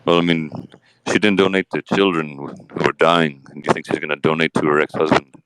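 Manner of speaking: a low, laid-back Johnny Depp answering; Johnny Depp impression. Host interviews Johnny Depp on his case, lightly voiced low. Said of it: "Johnny Depp".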